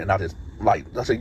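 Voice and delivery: ordinary talk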